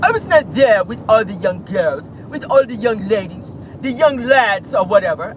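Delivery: half-hearted British impression; British accent. Vlogger mocking Prince Andrew's denial, British accent. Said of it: "can I do it in their accent?".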